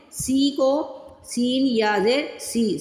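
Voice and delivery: normal talking voice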